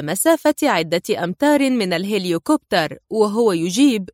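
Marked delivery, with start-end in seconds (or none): none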